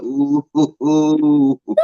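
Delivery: drawn-out teasing ooh dissolving into a laugh; a hammed-up spooky ooh then crack up; mock spooked ooh. Mock spooked reaction to devil story.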